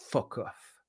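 normal talking voice

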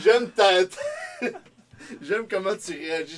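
laughing. Real, not acted. Laughing at friend's reaction.

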